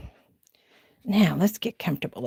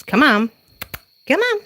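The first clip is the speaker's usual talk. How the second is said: much higher sing-song pet call; calling the cats; 8 semitones higher, 3 dB louder than usual. Real, not acted. Calling her cats into the house at night, high sing-song. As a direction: coax the kitties in with a high, louder call.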